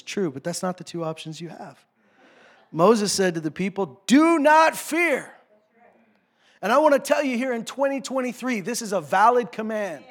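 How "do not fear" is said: slow, high and loud, every word hit hard; commanding shout. Acted. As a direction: thunder it out like Moses. Preacher quoting Moses' command.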